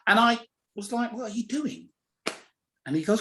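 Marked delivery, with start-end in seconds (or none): 1.1-1.9 higher, confrontational working class demand; blunt challenge. Retold or imitated. To the stranger touching his hair, a higher blunt challenge. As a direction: pitch it up and square up to him. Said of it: "I'm a working class kid".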